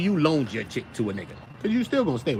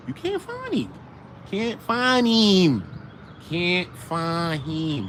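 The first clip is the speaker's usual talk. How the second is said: slow emphatic; 5 semitones higher, much slower than usual. Acted. Drawn out emphatic repetition.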